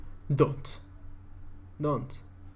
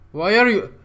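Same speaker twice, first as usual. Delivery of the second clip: ordinary talk, nothing marked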